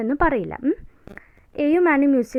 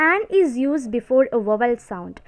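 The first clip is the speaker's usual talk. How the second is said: slow, word-by-word dictation for notes; much slower than usual. Shown as a demonstration. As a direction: read the rule out slowly so students can write. Dictating grammar rule slowly.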